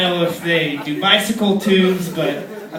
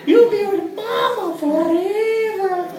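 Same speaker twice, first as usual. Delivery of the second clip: put-on high pleading baby voice; 9 semitones higher than usual. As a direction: whine it like a clingy toddler. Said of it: "Baby's like".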